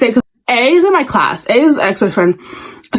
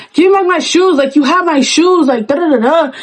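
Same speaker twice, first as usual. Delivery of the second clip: yelling; 6 semitones higher than usual. Retold or imitated. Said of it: "I'm yelling".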